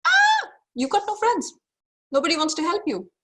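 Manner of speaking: high, loud whiny complaint; whining scarcity. Acted. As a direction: whine it like a sulking child. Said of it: "it's whining, it's complaining".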